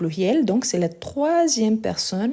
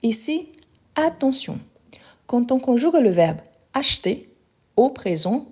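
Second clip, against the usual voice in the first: normal talking voice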